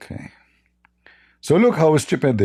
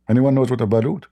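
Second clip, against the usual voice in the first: ordinary talk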